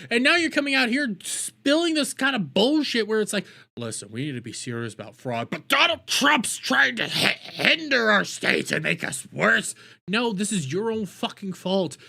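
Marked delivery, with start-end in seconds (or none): 3.8-9.7 14 and 15 in a put-on whiny politician voice; mocking politician. Mocking the governor's excuses about fraud, whiny put-on voice. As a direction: sneer it like a spineless governor. Mocking Walz's press statement.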